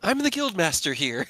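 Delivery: proud announcement. Acted. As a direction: announce it with mock importance. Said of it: "she kinda, like, looks around".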